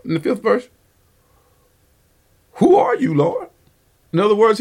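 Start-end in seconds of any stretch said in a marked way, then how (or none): 2.5-3.5 much higher, bewildered cry; startled question. Acted. Saul, knocked to the ground, asks the voice, startled high cry. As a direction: ask it like a man struck down and confused.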